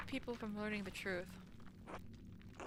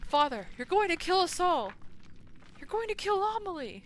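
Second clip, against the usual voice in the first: urgent plea; 8 semitones higher, 6 dB louder than usual. Acted. Pleading with the priest in-game.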